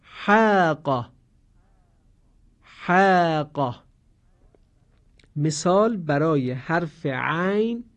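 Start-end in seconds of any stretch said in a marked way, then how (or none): 0.0-3.8 حاق drawn out very slowly with a thin آ. Shown as a demonstration. Teacher modelling حاق slowly for students. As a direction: stretch the آ in حاق, keep it light. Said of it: "صدای کشیدهٔ آ قرار گرفته".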